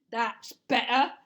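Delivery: loud gruff satisfaction; Burglar Bill voice. Acted. Burglar relieved the baby laughs, gruff and loud. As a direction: say it like a pleased rough burglar.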